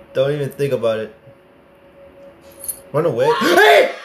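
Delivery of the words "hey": loud, high shout at the monster. Real, not acted. Gamer yelling at the chasing monster, loud high shout. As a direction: yell to scare it off.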